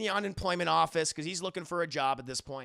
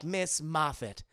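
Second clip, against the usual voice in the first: mock bad poetry; 4 dB louder than usual. The poet reciting bad verse to the café. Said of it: "shitty version of poetry".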